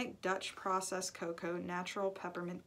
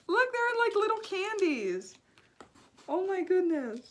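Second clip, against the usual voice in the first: high, loud burst of delight; thrilled as the candies tumble out; excited squeal; 11 semitones higher, 8 dB louder than usual. Real, not acted. Opening a gift box from April, excitedly squealing.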